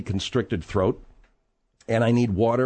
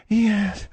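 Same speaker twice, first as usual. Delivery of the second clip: swooning sigh; 9 semitones higher than usual. Retold or imitated. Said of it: "she began to go".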